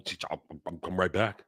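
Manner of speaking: meek fan mock. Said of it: "And, well, oh".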